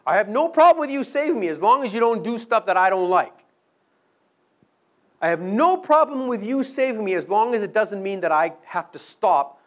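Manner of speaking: brisk, pushy bargaining with God; mock selfish prayer. Voicing the selfish believer's prayer.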